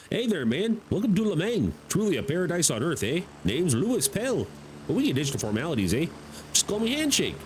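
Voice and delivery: high, swooping Canadian voice; Canadian accent. Acted. Player voicing Handshake's introduction, put-on high Canadian accent. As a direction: greet like a hammed-up Canadian. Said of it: "Oh, he's Canadian".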